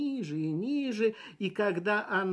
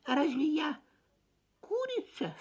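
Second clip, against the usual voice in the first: timid chick voice; 9 semitones higher than usual. A narrator's reading. Poor Fip asks timidly.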